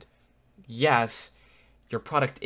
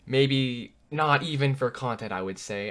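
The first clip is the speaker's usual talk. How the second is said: ordinary talk, nothing marked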